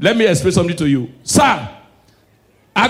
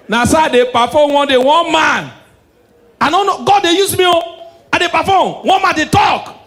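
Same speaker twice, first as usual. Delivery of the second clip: high, loud hammed-up pastor voice; mock shouting prophet; 9 semitones higher, 4 dB louder than usual. Comedian mimics prophet performing for crowd, loud mock shouting.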